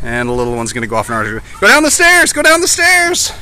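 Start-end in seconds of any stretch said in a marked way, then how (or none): 1.6-3.3 calling out. Real, not acted. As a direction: call them down the stairs. Directing the kids.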